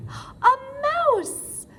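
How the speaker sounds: high, loud delighted cry; excited reveal. Real, not acted. Teacher revealing the answer to children, delighted. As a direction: announce it like a wonderful surprise.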